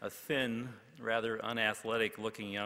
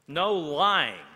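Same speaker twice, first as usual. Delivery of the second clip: higher, much louder call; 6 semitones higher, 12 dB louder than usual. A narrator's reading. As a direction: shout it out eagerly. Students calling out rules.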